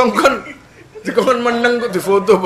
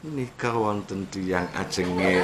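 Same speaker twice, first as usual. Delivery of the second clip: much softer, slow, overly refined; polite Solo speech; 10 semitones lower, 11 dB quieter, slower than usual. Retold or imitated. Solo gentleman politely addressing a man in trouble. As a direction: murmur it like a courtly Solo gentleman. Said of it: "Solo person speaks softly".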